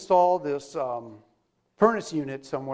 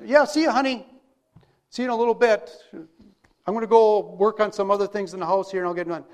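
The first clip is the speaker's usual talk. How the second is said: louder, raised casual husband voice; breezy, projected send-off; calling out goodbye; 2 semitones higher, 5 dB louder than usual. Acted. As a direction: act a husband calling to his departing wife. Acted husband calling goodbye to wife.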